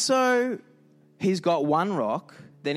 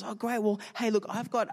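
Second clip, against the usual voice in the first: higher-pitched, chummy eagerness; eager friend; 8 semitones higher than usual. Acted. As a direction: play a friend angling for a favour. Second friend spots a chance.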